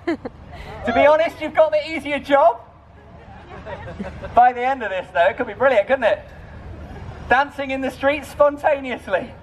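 high, projected street-show patter; street performer. Real, not acted. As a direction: pitch it high to reach the crowd. Street performer working a crowd.

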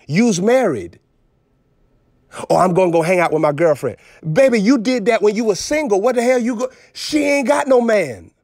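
usual speaking voice

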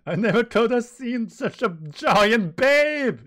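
high, loud, slow swooning voice; swooning admirer. Acted. Sanji swooning over a giant woman, high and slow. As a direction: gush over the beautiful giant.

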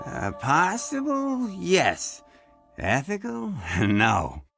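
drawn-out question, low answer; slow, wry hedging with a low chuckle; hedging reluctantly. Acted. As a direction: weigh each word, then drop low. Murph weighs a dodgy request.

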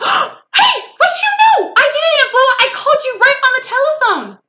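put-on very high, loud squealing kid; thrilled child. A narrator's reading. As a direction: shriek the news with delight. Child thrilled her phone call worked.

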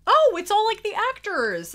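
high, loud burst of delight; delighted surprise. Real, not acted. Vlogger discovering a Star Wars picture, delighted surprise. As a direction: exclaim high as you realize what it is.

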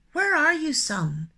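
calls up to the sky, loud and high; shouting. A narrator's reading. Hare shouts up to the hidden sun, loud call. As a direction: shout the question upward. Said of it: "Happy looked up and shouted".

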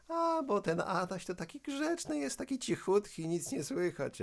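put-on high sweet elderly neighbour; old lady voice. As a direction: coo it like a kind granny. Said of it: "spotykam je na dole".

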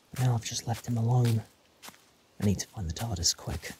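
muttering to himself. Acted. The Doctor alone in the forest, quietly frustrated. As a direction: mutter your worries under your breath.

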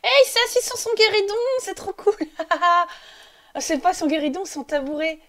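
high, loud burst of delight; excited squeal. Real, not acted. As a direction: squeal with joy at the cute sight. Excited shriek at Sims on guéridon. (cri de joie aigu et fort)

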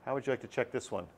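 ordinary talk

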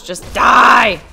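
loud frustrated yell at the enemy; angry shout. Real, not acted. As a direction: shout it, fed up. Fighting an enemy in game.

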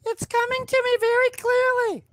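high mystical fortune teller voice; see the future in a high mystic tone; psychic hotline. Pretend. Fortune teller predicting a gear jam.

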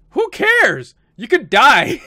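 high, loud outburst of protest; exasperated yell. Real, not acted. Gamer protesting a character leaving, exasperated yell. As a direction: shout it in exasperation at the screen.

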